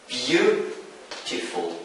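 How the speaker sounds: "beautiful" slow and soft, first syllable drawn out; stress on first syllable. Shown as a demonstration. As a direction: model "beautiful" slowly, leaning on "beau". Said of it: "the stress falls on the first syllable".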